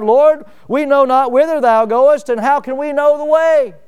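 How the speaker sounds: earnest Thomas. Acted. Thomas questioning Jesus.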